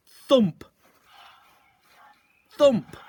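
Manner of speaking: rising loud thud; loud thump. A narrator's reading. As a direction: hit each thump harder. Thumps getting louder.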